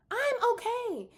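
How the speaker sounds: mock sister insisting. Sister claiming she's fine, mock high insistent tone. Repeated, higher and slower.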